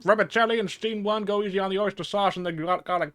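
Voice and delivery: bossy diner. Acted. Bossy diner orders dishes.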